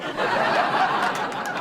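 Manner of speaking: squealing high-pitched laugh. Real, not acted. Laughing at her own punchline, a high squeal. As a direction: burst into a shrill giggle.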